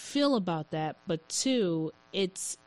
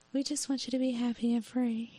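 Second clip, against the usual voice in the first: higher, soft, quick airy voice; mock airy parent; 5 semitones higher, 4 dB quieter, faster than usual. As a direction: sell the gentle American parent line. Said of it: "As we are like".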